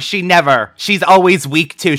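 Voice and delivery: normal talking voice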